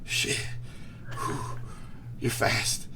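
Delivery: winded, broken into short gasped bits; out of breath. Acted. Rival arrives behind after the race, out of breath. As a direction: puff between the words, acting winded.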